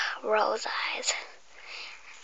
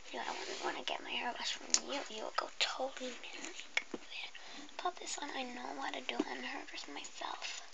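barely audible, much softer whisper; faint whisper; 15 dB quieter than usual. Acted. Customer mutters doubts about the hairdresser. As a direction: drop to a hushed breath.

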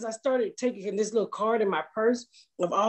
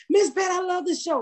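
high-pitched polite gushing fan; 10 semitones higher than usual. Retold or imitated.

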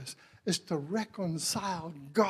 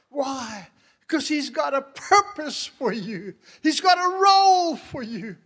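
loud, high, rising to a shout; impassioned pulpit shout; full-force preaching; 7 semitones higher, 11 dB louder than usual. Real, not acted. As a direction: preach it at full force, building on each phrase.

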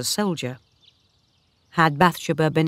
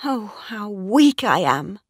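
higher-pitched, dismayed exclamation; distressed cry; 5 semitones higher than usual. A narrator's reading. Mistress cries out to her maid, distressed. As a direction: lament your own weakness, pitch raised. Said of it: "cried Bathsheba".

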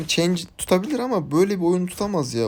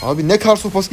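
loud, higher exclamation of alarm; startled outcry; 5 semitones higher, 9 dB louder than usual. Real, not acted. Gamer caught off guard in the match, startled outcry. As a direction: cry out in sudden surprise.

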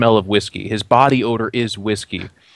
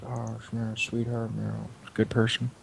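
mumbling to himself; 13 dB quieter than usual. Acted. Ferryman mumbles praise of the deer girl to himself. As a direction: mumble it away to yourself. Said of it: "kinda mumbling to himself".

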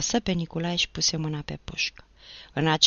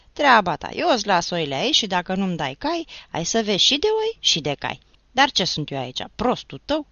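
angry retort; 4 semitones higher, 6 dB louder than usual. Acted. Ciobanul se ceartă cu pândarul.

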